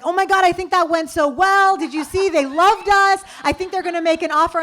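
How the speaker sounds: mock gushing partner; excited cofounder. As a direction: burst with naive delight. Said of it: "he's like".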